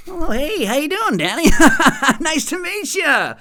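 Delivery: high sugary fake-nice hello; fake corporate politeness. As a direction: put on a chirpy polite work voice. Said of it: "you gotta be all like".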